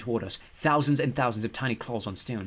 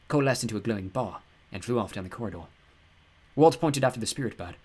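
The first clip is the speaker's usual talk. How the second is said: usual speaking voice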